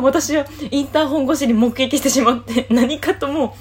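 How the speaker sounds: can't keep a straight face telling it; laughing. Real, not acted. Recalling the funny moment.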